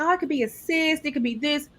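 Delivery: a lightly mocked casual doctor; mock nonchalant doctor. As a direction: toss it off like it's nothing. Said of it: "She was very nonchalant".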